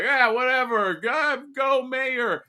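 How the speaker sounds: high, careless shrug of a cheer; dismissive voters. Retold or imitated. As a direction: toss it off like nobody cares. Mocking indifferent voters.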